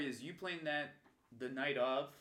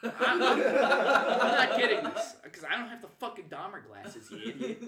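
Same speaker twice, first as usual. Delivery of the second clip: laughing retort; 8 semitones higher, 11 dB louder than usual. Retold or imitated. Retorting to yelling guy.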